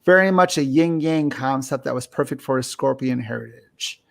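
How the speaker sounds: normal talking voice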